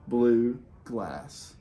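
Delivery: plain reading voice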